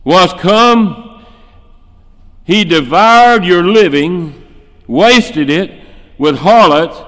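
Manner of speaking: scornful accusation. Acted. Older brother accusing the prodigal before his father, scornful and loud. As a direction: spit out the brother's sins.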